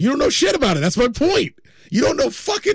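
high, exasperated protest; worked-up, high-pitched objection; heated outburst. Real, not acted. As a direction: argue it hard, voice jumping up. Heated challenge to co-host's claim.

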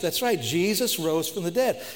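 loud, high proclamation, ends rising; preaching at full force. Acted. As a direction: ring out the news of the resurrection.

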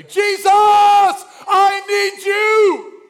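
desperate shout to Jesus, very high and loud, slow; cry out. Shown as a demonstration. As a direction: cry out with everything you have. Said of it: "to cry out to say".

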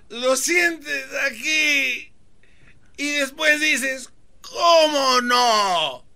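high, slow, straining yell; strained shout. Shown as a demonstration. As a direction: push it out like lifting something heavy. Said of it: "di como Fermín".

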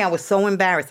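usual speaking voice

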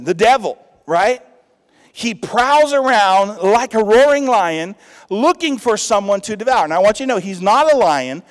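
rally the congregation; preacher's loud exhortation; full-force preaching. Acted.

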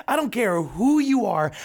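ordinary talk, nothing marked

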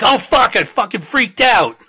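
mock freak-out outburst; mock panicked yell. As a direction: blurt it out in hammed-up panic. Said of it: "he's like".

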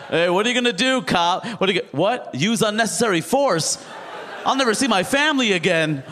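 high-pitched mock bravado; hammed-up defiance, pitched high; mock taunting.

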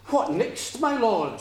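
high, loud mock deference. A narrator's reading. Lassie asks the puddock for orders, mocking. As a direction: swoop up high in sarcastic servility. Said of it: "mocking him".

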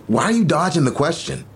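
exasperated high question. Acted. Boyfriend pressing her why she dodges, voice rising. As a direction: push back, voice up in frustration.

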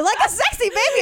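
put-on high, loud cooing baby voice. Shown as a demonstration. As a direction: coo it high like a Kardashian. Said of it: "trying to do it like a Kardashian".

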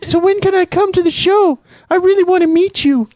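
put-on high falsetto girl voice; eager young woman, high; man doing a woman's voice. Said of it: "You do a girl voice better than me".

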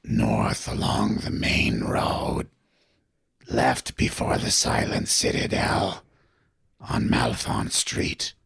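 low unvoiced skeletal rasp; creepy whisper. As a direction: whisper like a crucified corpse. Skeleton signpost gives directions.

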